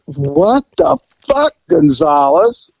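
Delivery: slow, drawn-out shocked question; stunned surprise. Retold or imitated. Gamblers see the officer and stall in shock. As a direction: sound caught red-handed.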